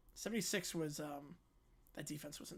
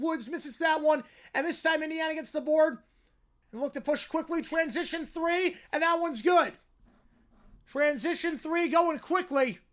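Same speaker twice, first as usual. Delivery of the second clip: raised, driving basketball commentary with punched words; excited play-by-play; 9 semitones higher, 10 dB louder than usual. Real, not acted. Commentator calls rebounds and a made three, excited play-by-play. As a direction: ride the action shot by shot, urgent and projected.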